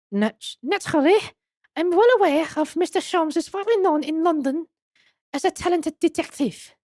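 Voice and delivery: put-on high-pitched madam with French accent; French museum madam. Madam explaining the waxwork's business proposal, high French accent. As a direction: play her high and French.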